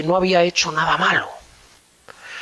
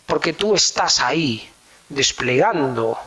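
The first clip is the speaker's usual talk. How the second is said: usual speaking voice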